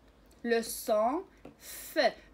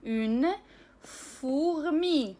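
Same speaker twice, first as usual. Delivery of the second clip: slow reading; 4 dB louder, much slower than usual. Shown as a demonstration. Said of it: "لقراءة بعض الكلمات".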